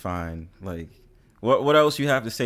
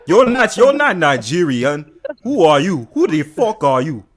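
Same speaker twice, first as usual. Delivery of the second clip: indignant mock Nigerian accent. Streamer poses as an insulted Nigerian, mock-angry Nigerian accent. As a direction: scold in a put-on Nigerian accent. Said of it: "I am really Nigerian".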